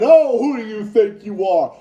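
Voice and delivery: loud, sneering put-down, mocking a pundit; scornful imitation of a smug host; condescending mockery. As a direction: ridicule him as he talks down to someone. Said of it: "literally talking down to him".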